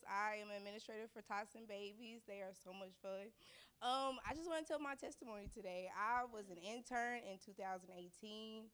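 ordinary talk

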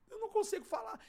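very high, strained plea; retold overwhelmed wail. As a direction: imitate being too shaken to talk. Retold himself too overwhelmed to speak. (lamento agudo, imitando choro)

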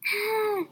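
high scream. A narrator's reading. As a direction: wake up screaming. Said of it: "screamed Ralph".

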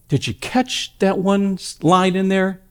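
ordinary talk

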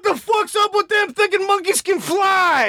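high, hammed-up right-wing outrage; mock Chuck Woolery tweet. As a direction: sneer it like an indignant troll. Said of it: "his whole tweet is like".

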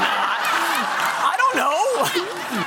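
high, slow, laughing stammer of a caught-out sister; flustered laughing reply. Retold or imitated. Sister stuck for an answer to her brother, a high laughing stammer.

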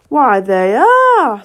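slow, drawn-out gloating king; triumphant. A narrator's reading. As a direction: crow it out slowly in triumph. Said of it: "said the king triumphantly".

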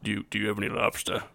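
mild movie idol impersonation; a hint of a star making a request; Clark Gable. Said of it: "this is my Clark Gable".

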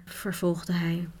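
ordinary delivery

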